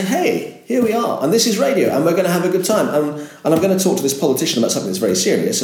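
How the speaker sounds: high, fast and bright, selling the fun; upbeat presenter voice. Shown as a demonstration. Radio host showing how to greet listeners, upbeat. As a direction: pump it up like a cheery DJ. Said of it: "you have to just go and say".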